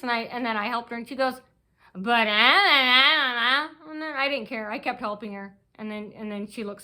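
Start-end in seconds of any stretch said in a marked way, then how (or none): 1.9-3.9 loud, drawn-out mock wail; mimicked whining stammer. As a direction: stammer it out as a mocking cry. Mimics woman's stammering protest.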